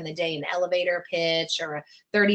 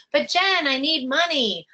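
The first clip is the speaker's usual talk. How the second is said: higher-pitched imitated protest; mock pleading; 6 semitones higher, 3 dB louder than usual. Client objecting to the coach's advice, mock pleading. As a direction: plead like a worried client, hammed-up.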